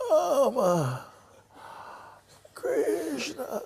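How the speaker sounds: high, strangled call of the names; strained dying cry. Retold or imitated. Dying father straining to call his sons. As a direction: reach for them with your last breath.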